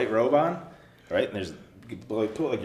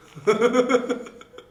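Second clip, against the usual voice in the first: loud high laugh; 8 dB louder than usual. Real, not acted. Teller laughs.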